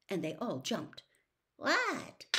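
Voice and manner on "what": high, falsely innocent question. A narrator's reading. George feigns innocence to his mother, mock-innocent. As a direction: play innocent, badly. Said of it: "in an innocent tone that deceived nobody".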